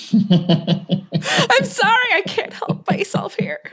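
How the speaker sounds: giggling apology, breaking into a whisper; laughing. Real, not acted.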